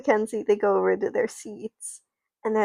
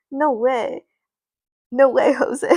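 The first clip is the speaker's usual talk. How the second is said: laughing dismissal; 3 semitones higher, 7 dB louder than usual. Retold or imitated. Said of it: "he goes".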